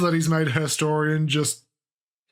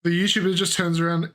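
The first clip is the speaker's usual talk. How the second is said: ordinary delivery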